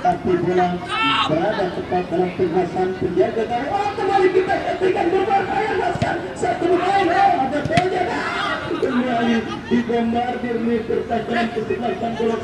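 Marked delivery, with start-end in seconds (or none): 3.7-8.2 excited commentary. Acted. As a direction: jump up as the chance comes. Commentator reacts to danger.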